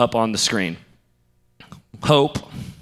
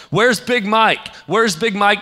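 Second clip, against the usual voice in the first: high, louder, slow shout of a search; calling out; 8 semitones higher, 4 dB louder, slower than usual. Retold or imitated. Asking scalpers on the street, high searching shout. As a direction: call across the street for him.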